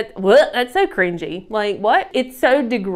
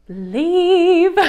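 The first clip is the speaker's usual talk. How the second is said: emphatic leave; 7 semitones higher, 3 dB louder than usual. Real, not acted. Telling haters to go.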